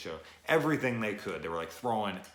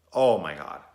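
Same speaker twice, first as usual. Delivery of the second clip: loud exclamation; 12 dB louder than usual. Real, not acted. Overwhelmed by the movie scene.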